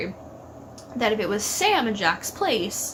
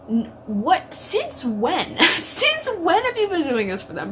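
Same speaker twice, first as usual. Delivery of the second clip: half-laughing disbelief, rising higher; incredulous laughing retort; 7 semitones higher, 3 dB louder than usual. Retold or imitated. Fan mocking Dean's claim, laughing in disbelief. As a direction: scoff in amazed disbelief.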